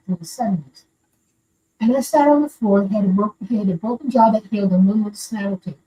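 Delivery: ordinary talk, nothing marked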